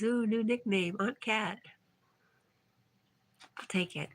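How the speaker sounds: ordinary talk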